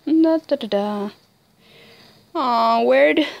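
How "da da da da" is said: ordinary talk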